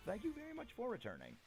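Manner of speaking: usual speaking voice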